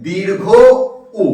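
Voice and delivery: "দীর্ঘ", a clear pause, then "উ"; correct letter name. Shown as a demonstration. Teacher modelling for students, "দীর্ঘ" and "উ" held apart. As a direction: say "দীর্ঘ উ" as two separate parts. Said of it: "এর উচ্চারণটা ঠিক হয়ে যাবে".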